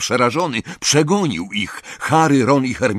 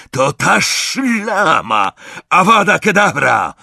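higher, louder hostile cry; shouted curse; 7 semitones higher, 4 dB louder, slower than usual. A narrator's reading. Crape firing a curse at Hermiona, shouted. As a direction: hurl it out like an attack. Villain spots enemy, casts killing curse.

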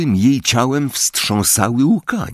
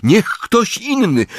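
urgent plea; 13 semitones higher, slower than usual. Acted. Harry urges someone else.

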